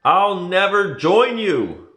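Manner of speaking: high, loud, slow refusal; defiant shout. A narrator's reading. Luke refuses Darth Vader, loud, high and slow. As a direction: hurl it back in defiance. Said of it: "Luke said".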